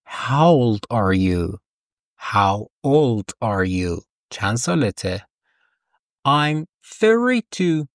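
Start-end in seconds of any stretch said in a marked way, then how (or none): 0.1-4.0 drawn-out careful English; teacher modelling very slowly; very slow model question. Shown as a demonstration. 6.3-7.9 slow, clear number answer; teacher modelling slowly; slow model answer. Shown as a demonstration. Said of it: "همین کافیه".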